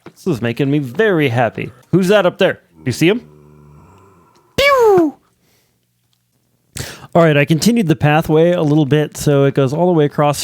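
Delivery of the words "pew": high, loud mouth laser sound; laser pew noise. Pretend. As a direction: fire a cartoon laser pew. Shooting at mob up there.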